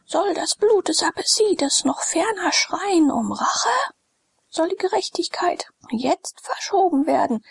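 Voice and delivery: high, impassioned priest's appeal; fanatical outcry. A narrator's reading. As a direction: rouse the crowd to fury. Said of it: "rief Calenus".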